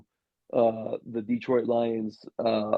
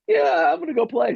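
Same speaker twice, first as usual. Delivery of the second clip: high, keen burst of agreement; eager yes; 4 dB louder than usual. Retold or imitated. Player accepting a call to go play, eagerly. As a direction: jump at the offer, pitch shooting up.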